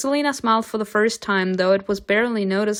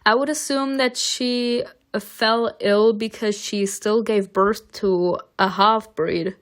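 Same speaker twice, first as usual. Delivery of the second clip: ordinary talk, nothing marked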